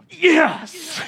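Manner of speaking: high, loud exultant shout; re-enacted thrilled outburst; pumped-up cheer. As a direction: yell it in triumph. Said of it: "so it gets me ticking".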